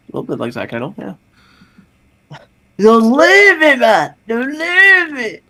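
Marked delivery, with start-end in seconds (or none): 2.8-5.4 high-pitched, hammed-up cry; mock desperate plea. Begging a friend not to leave, hammed-up high wail. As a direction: wail it high in a put-on voice, stretching the last plea.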